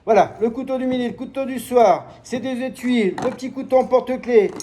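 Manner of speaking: high, loud fairground sales patter; market hawker pitch. Shown as a demonstration. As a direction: sell it like a market barker.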